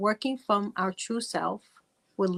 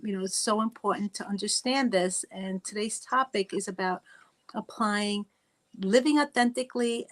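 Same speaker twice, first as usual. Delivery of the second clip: ordinary talk, nothing marked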